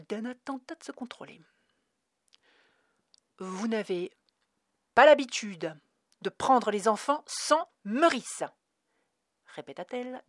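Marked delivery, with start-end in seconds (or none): 3.4-8.4 slow, each syllable detached and pressed. Acted. Dana repeating the clerk's words back, syllable by syllable. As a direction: spell it out coldly, one syllable at a time. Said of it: "détachant chaque syllabe".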